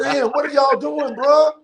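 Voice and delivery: high, bursting disbelief; incredulous. Retold or imitated. Newcomer baffled by singers' warm-ups, high incredulous voice. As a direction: throw up your hands, what is this. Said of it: "I'm like".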